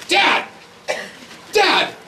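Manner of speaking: put-on eager kid pleading with his dad; teen son voice. Son begging dad about bungee jumping, excited.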